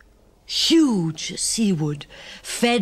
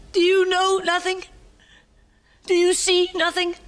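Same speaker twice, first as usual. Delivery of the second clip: gasping, much higher cry of despair; desperate gasp; 13 semitones higher, faster than usual. Acted. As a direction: she shakes him for an answer. Woman's gasping, desperate questions.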